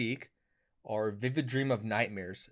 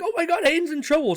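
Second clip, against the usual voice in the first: high, loud panicked exclamation; mock panic; 9 dB louder than usual. Dreamer hearing his friend in trouble, mock panic. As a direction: blurt it out in hammed-up alarm.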